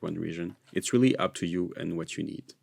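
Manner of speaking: ordinary delivery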